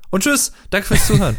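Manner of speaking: high, loud cheery goodbye; bright singsong outro; chirpy sign-off. Acted.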